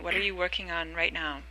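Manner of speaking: usual speaking voice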